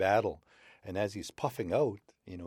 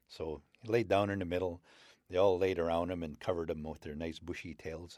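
ordinary delivery, nothing marked

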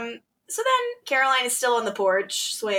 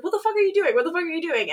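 retold freak-out, pitched up; mock alarm, higher voice; 6 semitones higher, much faster than usual. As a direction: imitate someone losing it. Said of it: "he's freaking out".

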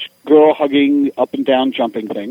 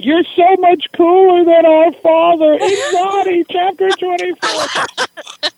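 very high, louder shrieking fan voice; screaming fangirls; 14 semitones higher, 3 dB louder, slower than usual. Retold or imitated. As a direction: scream it like teenage fans mobbing him.

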